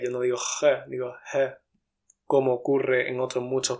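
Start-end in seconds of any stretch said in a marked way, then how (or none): 0.4-0.9 "G" as a hard raspy jota; strong G. Shown as a demonstration. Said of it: "se ha relajado también la G". 1.3-1.6 Andalusian aspirated jota; relaxed G. Shown as a demonstration. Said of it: "se ha relajado también la G".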